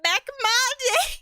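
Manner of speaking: put-on very high, slow old-timer cry; old man. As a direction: squeal out a drawn-out old man imitation. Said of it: "It just here would be like".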